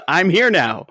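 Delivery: cocky, self-satisfied announcement; smug mock entrance. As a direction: strut in like you own the place.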